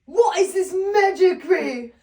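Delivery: loud, high, booming fake god voice; hammed-up Thor impression; horrible Thor accent. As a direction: bellow it like a baffled thunder god. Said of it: "that's such a horrible Thor accent".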